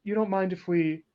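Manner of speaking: high, hopeful asking voice; excited, high-voiced plea; eager request. Voicing guest eager to pet dog.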